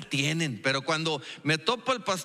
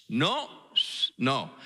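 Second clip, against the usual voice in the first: usual speaking voice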